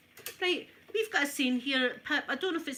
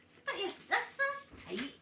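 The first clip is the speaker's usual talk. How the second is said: high indignant disbelief; indignant squeak; 11 semitones higher than usual. Real, not acted. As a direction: squeak out the outrage. Indignant at the party.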